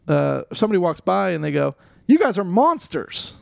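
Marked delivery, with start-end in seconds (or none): 2.1-3.4 high, drawn-out judgmental call; appalled heckle. Retold or imitated. Passer-by shaming two people, appalled heckle. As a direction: scold them in slow, raised disgust. Passer-by scolding them, acted.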